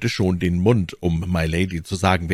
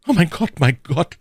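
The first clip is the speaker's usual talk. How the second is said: much higher, louder frightened maid; stammering; 11 semitones higher, 5 dB louder than usual. Acted. Cathy the maid panics at the ruse, stammering. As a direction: gasp it out in panic. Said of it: "stammelte Cathy".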